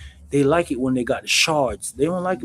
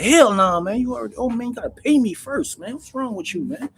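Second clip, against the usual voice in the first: higher, mock-outraged refusal; dismissive dealer; 9 semitones higher than usual. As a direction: shut him down, he owes you. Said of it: "You be like".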